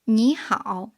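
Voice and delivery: "ni" rising as second tone before "hao"; third-tone sandhi. Shown as a demonstration. Teacher showing two third tones in a row, "ni" rising before "hao". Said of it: "should be pronounced in the second tone".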